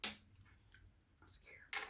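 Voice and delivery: breathy little whisper. Real, not acted. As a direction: whisper it nervously. About to try new dish.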